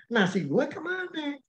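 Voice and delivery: indignant question. Retold or imitated. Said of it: "Tanya pasangan".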